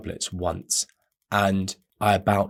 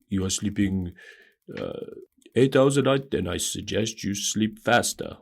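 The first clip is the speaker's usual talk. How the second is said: put-on Schwarzenegger accent; 4 dB quieter than usual. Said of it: "He's, he says something like".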